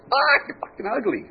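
high opening squawk, dropping into the insult; squawking parrot. Acted. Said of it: "the parrot goes".

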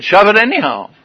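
loud, high retold defiance; defiant retort. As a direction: double down on the rude brush-off, hammed up. Repeats the rude retort, louder.

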